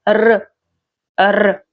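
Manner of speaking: trilled Russian R sound; rolled R. Shown as a demonstration. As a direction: roll the R as an isolated sound. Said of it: "manage to roll this letter".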